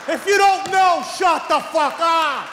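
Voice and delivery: loud high yell; shouted rant. Real, not acted. Comic yelling at government, loud and high. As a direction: blast it at the politicians.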